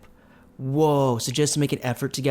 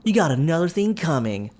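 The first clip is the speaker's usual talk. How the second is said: mock scolding father; 8 semitones higher than usual. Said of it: "criticize for being swayed".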